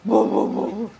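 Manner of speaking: loud punchy woofs; playful mock dog bark; imitated barking. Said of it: "Suaramu tuh gonggong itu".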